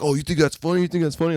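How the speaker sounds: squaring up at a nerd; bully taunt. Retold or imitated. Bully challenging in cafeteria.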